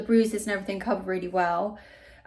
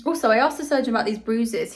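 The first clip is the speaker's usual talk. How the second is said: ordinary talk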